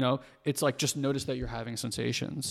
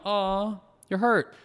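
higher-pitched mock parental cooing; sympathetic parent; 7 semitones higher, 5 dB louder, much slower than usual. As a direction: coo over a hurt baby, acted out. Parent voiced soothing hurt baby.

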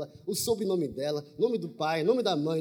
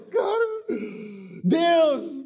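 higher, louder nervous plea; urgent plea; 7 semitones higher, 5 dB louder, much slower than usual. Retold or imitated. Praying silently beside the girl, in a nervous higher plea. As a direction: beg God in a panic.

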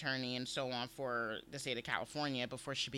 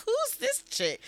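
high, loud, mock-astonished; amazed reaction; 6 dB louder than usual. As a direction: relive being taken aback by her. Acting out own amazed reaction.

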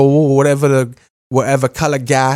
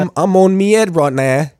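higher mock cockney; hammed-up cockney accent; 6 semitones higher than usual. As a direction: lampoon the British star. Mocking Bale's British speech.

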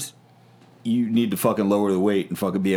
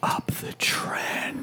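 whisper; 7 dB quieter, much slower than usual. Pretend. Echoing a joke, whispered.

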